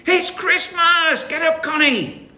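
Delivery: high, slow, loud cheer; excited shouting twins. A narrator's reading. Twins diving on Connie's bed, shouting. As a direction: wake her up with joy.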